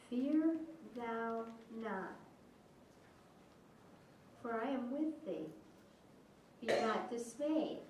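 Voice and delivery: slow, soft, reverent reading; scripture reading. Acted. Pastor reading Isaiah to the congregation, hushed. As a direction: read each phrase quietly with pauses.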